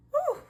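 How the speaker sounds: very high, loud woo; shocked whoop. Real, not acted. Reacting to the confession, a high shocked whoop. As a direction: let out a stunned whoop.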